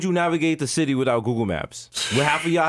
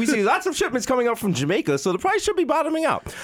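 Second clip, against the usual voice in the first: mock weather report delivery; news anchor voice; 6 semitones higher than usual. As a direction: read it like a TV newscaster.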